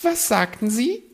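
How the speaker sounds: high, preening bird voice; a vain magpie fishing for compliments. Acted. Magpie asks sharply.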